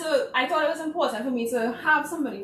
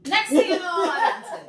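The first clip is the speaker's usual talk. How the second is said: higher and louder, laughing; 10 semitones higher, 6 dB louder than usual. Real, not acted. Guest laughing as she finishes the host's joke. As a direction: deliver the punchline laughing.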